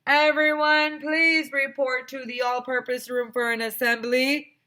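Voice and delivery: high, loud, slow announcing; loudspeaker announcement. Acted. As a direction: read it over the PA.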